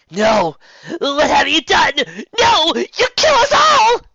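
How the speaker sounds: high, loud, slow dying wail; doomed enchantress shriek; despairing scream. A narrator's reading. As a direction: scream as everything collapses. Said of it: "said Torka".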